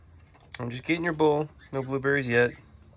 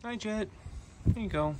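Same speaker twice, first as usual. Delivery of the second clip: much higher, soft coaxing; high pet talk; 11 semitones higher, 4 dB quieter than usual. Real, not acted. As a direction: coax the pet sweetly. Greeting the pet.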